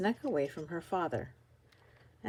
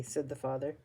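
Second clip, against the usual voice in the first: plain reading voice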